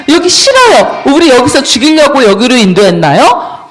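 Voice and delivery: high, loud indignant protest; hammed-up outraged complaint; acted angry confrontation. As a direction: confront the leader with an accusing question. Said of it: "막 몰려가서 막 따지고 있어요".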